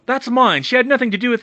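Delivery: Bill shouting at Bellatrix to protect Hermione; yell. Acted. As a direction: shout to draw her off. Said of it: "Bill yelled".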